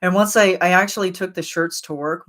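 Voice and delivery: ordinary talk, nothing marked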